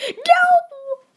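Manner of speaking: very high, loud No; desperate scream. Real, not acted. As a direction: scream it as everything goes wrong. Game loss moment.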